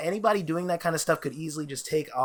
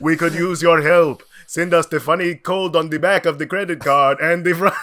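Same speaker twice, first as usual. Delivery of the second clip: higher, loud character appeal; hammed-up scammer plea in a put-on voice; Carveth; 4 semitones higher, 6 dB louder than usual. AI scammer wanting card code, hammed-up. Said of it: "They make an A I Carveth".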